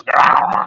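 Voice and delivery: a short playful growl in imitation; announcer growl. Mock growl for a home run call. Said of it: "and then he goes".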